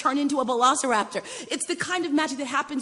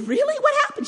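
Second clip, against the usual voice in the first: much higher, louder "Really?"; surprised; 6 dB louder than usual. Retold or imitated. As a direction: jump up in astonishment at "Really?". Surprised reply to daughter.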